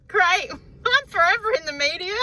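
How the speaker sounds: high, laughing sarcasm about being on TV; sarcastic laughing. Real, not acted. Mock despair at making news.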